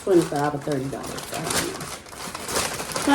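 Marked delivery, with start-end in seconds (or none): 1.3-3.1 whisper. Real, not acted. Unsure about the price.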